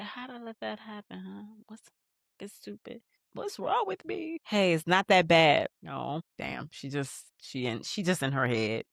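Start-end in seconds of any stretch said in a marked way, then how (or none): 0.0-4.4 much softer, muttered to herself; hushed, guilty rehash of the same line; quiet self-blame. Retold or imitated. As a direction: say it under your breath in guilt. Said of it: "She's like".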